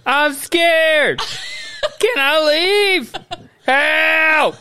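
high, wailing plea; mock screaming. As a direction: play scared, well over the top. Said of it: "laying on the ground screaming".

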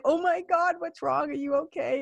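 a startled teacher checking on a crying student; concerned teacher. Retold or imitated. Teacher reacting to her tears.

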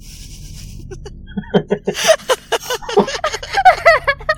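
high, soft, drawn-out laughter; helpless high giggle; long giggling laugh. Real, not acted. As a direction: laugh on and on, quietly. Long laugh amid banter.

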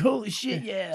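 excited fan. Retold or imitated. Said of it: "That one guy's gonna be like".

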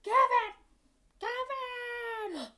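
high, loud cry of the name, drawn out on the second; panicked mother's shout. Retold or imitated. Mother realising she forgot her kid, high shout. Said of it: "she's the one that's like".

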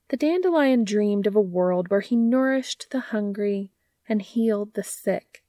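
plain reading voice

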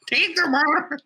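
high hammed-up wail; mock wailing Mapes. Listener teasing the reading, high wail. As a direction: squeak it like the silly reading. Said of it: "without hearing".

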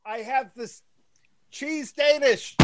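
tiny high squeaky voice, soft; sped-up chipmunk voice; shrunk tiny voice. Acted. Tesla shrunk by sound machine.

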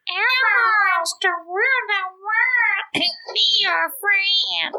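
very high, bright Elmo impression; sing-song high puppet voice. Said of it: "we almost turned you into Elmo".

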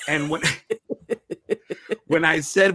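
ordinary talk, nothing marked

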